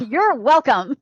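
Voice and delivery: punchy mock tirade, playfully copied; mock-indignant imitation. Listener echoing the other mom's tantrum, playfully indignant. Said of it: "I love how you just vocalize though".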